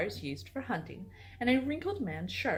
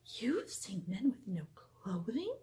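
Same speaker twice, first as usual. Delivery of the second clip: a hushed, breathless whispered question; soft whisper; 6 dB quieter than usual. A narrator's reading. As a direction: whisper it, scandalised and secretive. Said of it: "then whispered softly".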